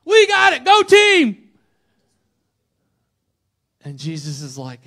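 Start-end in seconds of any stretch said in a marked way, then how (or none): 0.1-1.5 much higher and louder eager cheering; brash enthusiastic team shout. Retold or imitated. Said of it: "her and her two boys".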